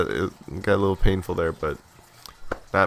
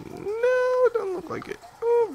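high squeaky voice. Pretend. High squeak at a game setback.

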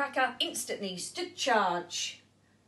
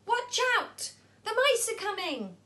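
alarmed cry; 11 semitones higher than usual. Acted. Unseen lookout shouting a warning of mice. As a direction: call out the danger to everyone.

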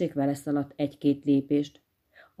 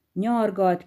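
plain reading voice

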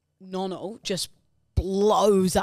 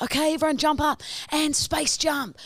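higher, bossy drama coach voice; mock drama teacher; 10 semitones higher, 3 dB louder than usual. Nervous guest imagining the drama teacher's orders, hammed-up. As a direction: play an enthusiastic acting teacher. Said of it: "I'd be like".

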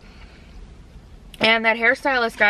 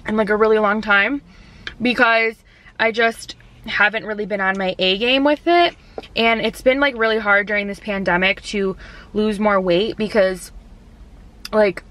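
speaking between bites; slightly muffled by food; talking while chewing. Real, not acted. Seen 1: took a bite before; lips pressed, chewing and forking food throughout.

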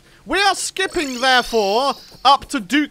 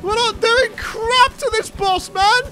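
whiny despair; 13 semitones higher than usual. Acted. Despair at losing boss fight.

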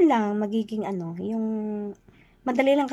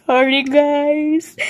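apology; 6 semitones higher, 7 dB louder, much slower than usual. Real, not acted. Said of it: "Para ba ako naka-microphone?".

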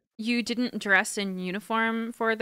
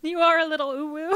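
high giggly teasing; laughing; 9 semitones higher than usual. Real, not acted. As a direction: laugh through the words. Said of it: "laughter heard".

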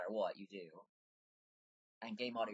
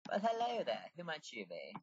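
character greeting; 6 semitones higher than usual. Acted. Voices a character met in game.

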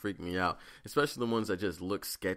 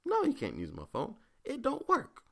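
much higher, snippy refusal; mock curt refusal; 13 semitones higher than usual. Refusing the lady his phone, high mock refusal. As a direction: shut her down, squeaky and final. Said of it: "I was just like".

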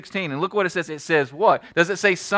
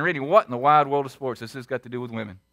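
skeptical listener voice; 7 semitones lower, faster than usual. Retold or imitated. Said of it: "You're saying".

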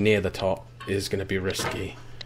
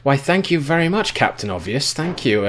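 sarcastic; 6 semitones higher, 7 dB louder than usual. Real, not acted. Mocking the narrator's advice.

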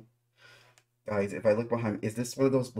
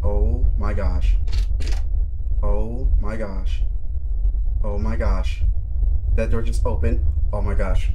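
loud, drawn-out cries of alarm; startled horror-game player yelling; real jump-scare shock; 10 dB louder than usual. Real, not acted. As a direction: blurt it loudly in genuine shock.

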